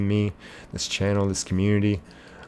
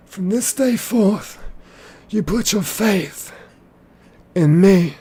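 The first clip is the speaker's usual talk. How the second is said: high, drawn-out villain proclamation; Lord Voldemort; 11 semitones higher, 5 dB louder, much slower than usual. Acted. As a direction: stretch each phrase slowly in a high, commanding villain voice. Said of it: "Lord Voldemort quote".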